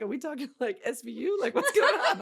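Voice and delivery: high, climbing, laughing bewilderment; baffled disbelief. Retold or imitated. Retelling her clueless reply about forensics, comically baffled.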